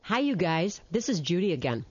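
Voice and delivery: usual speaking voice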